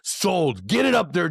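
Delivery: ordinary talk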